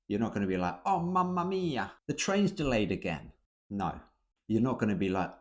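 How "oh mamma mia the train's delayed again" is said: high, theatrical Italian-style wail; over-the-top dramatic. Shown as a demonstration. Teacher mocking how Brits don't complain, overly dramatic. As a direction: ham up the despair like an opera. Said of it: "not dramatic".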